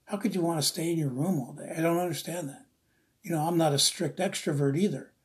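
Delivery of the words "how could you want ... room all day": ordinary talk, nothing marked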